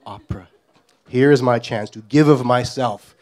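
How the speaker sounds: plain reading voice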